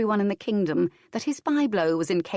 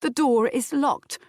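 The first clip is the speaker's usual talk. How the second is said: smug declaration; 7 semitones higher, 3 dB louder than usual. A narrator's reading. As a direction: declare it like a won battle. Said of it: "Fitztudor declared".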